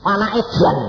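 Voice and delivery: angry papa. Retold or imitated. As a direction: snap it as a cross father. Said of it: "Papa marah".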